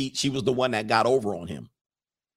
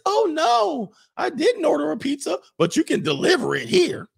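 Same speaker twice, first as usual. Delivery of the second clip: much higher mock female voice; flirty woman voice; 4 dB louder than usual. Woman answering the pizza guy, high coy mock voice. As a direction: squeak it up like a coy lady. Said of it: "And she says".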